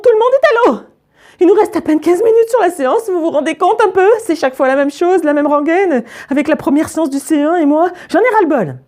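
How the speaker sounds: high-pitched exasperated rant; acted fed-up scolding, pitched high; furious. A narrator's reading. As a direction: tell off the class, at the end of your tether. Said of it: "Elle a l'air furax".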